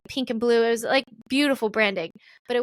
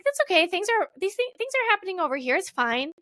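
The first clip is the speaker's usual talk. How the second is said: much higher, singsong self-reassurance; forced it's-fine; 8 semitones higher than usual. Retold or imitated. To herself while taking the CMO role, high forced cheer. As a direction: chirp it like everything is fine.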